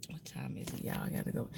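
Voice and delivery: hushed aside, barely voiced; whisper. Real, not acted. Whispering an aside to viewers about having to leave. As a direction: drop to a breathy whisper as if someone might hear.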